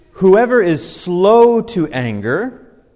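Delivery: slow, weighty reading of a proverb; slow, solemn Bible reading; scripture reading. Acted. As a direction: read it out like holy writ, slowly. Reads scripture aloud.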